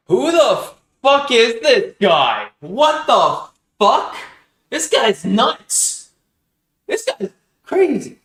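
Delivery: loud, high, slow shocked outburst; shocked disbelief. Real, not acted. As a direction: blurt it out in stunned amazement. Reacting in disbelief to video.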